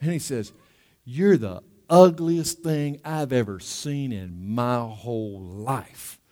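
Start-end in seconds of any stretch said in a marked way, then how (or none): 1.1-6.2 very slow, sinking lower and softer; drawn-out, deadpan parrot put-down; insulting parrot. Acted. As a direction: draw the insult out word by word. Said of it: "the parrot looks at him".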